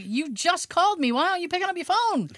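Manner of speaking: high, fast nagging mom; annoyed mom. Retold or imitated. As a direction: nag like an impatient mom. Voices mom complaining.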